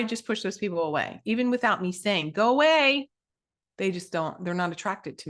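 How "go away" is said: loud, high mock send-off; acted dismissal. Coach imagining telling unsuited people to leave, loud dismissal. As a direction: call it out to shoo them off.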